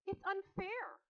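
very high, plaintive wail; sobbed protest. A narrator's reading. Girl protesting to her father, mock sobbing. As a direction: protest as if near tears. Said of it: "she sobbed".

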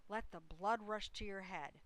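ordinary delivery, nothing marked

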